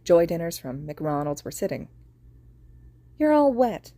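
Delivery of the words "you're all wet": put-on high, loud child's complaint; little girl complaining. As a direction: whine it at him. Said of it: "Hana complained".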